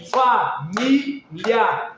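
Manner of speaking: "família" in three very slow beats, fa-mí-lia; syllable by syllable. Shown as a demonstration. Teacher demonstrating sound emissions, "família" slowly beat by beat. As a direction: split "família" with pauses, lia as one beat. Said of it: "se você pensar numa emissão sonora".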